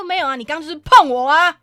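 loud, sharp accusation in public; sudden loud callout. Retold or imitated. On the train, calling out the man who groped her, loud. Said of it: "我就瞬间转换我那个语调".

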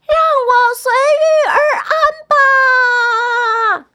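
very high, loud, quick squeaky pea; little pea's cry. A narrator's reading. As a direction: cry out fast in a tiny character's high voice. Last pea calls out as launched. (又高又响又快的小豌豆喊声)

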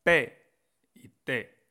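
P y T with a puff of air; consonantes aspiradas. Shown as a demonstration. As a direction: pop the P hard in "P y T". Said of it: "sale mucho aire de mi boca". (P y T soltando aire)

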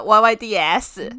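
slow, emphatic mainland-style Y Y D S; drawn-out mainland fan slang; mainland Chinese accent. Shown as a demonstration. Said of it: "中国的口音才有那个气口".

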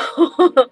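loud embarrassed laugh; sudden high laugh; burst of laughter. Real, not acted. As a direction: laugh out at yourself. Laughing at own bad driving.

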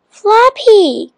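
high-pitched call to the dog. A narrator's reading. Biff tries to stop the barking dog, calling out. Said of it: "called Biff".

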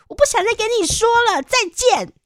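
high, loud, huffy brush-off; huffy goodbye. Retold or imitated. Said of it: "我就很生气的说". (傲娇地提高音量说再见)

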